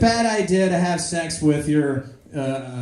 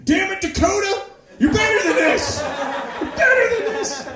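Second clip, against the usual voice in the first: high-pitched mock yell; imitated self-directed anger; 13 semitones higher, 4 dB louder than usual. Disc golfer yelling at himself after a shank, mock furious. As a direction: scream at yourself in comic fury. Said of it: "do the same thing".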